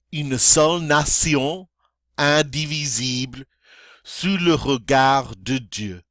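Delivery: careful model reading with pauses between lines; line-by-line French recitation. Shown as a demonstration. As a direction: recite it in measured French phrases for learners to follow. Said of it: "I'll go line by line".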